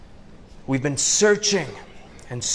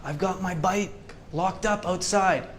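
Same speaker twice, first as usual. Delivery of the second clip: pleading a bit higher, hurrying the cashier; mock urgent plea, softer than his speech; impatient plea; 5 semitones higher, 5 dB quieter than usual. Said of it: "and I say".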